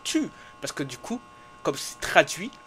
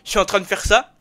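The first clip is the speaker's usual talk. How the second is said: yelling; 3 semitones higher, 16 dB louder than usual. Real, not acted. Streamer yelling over game.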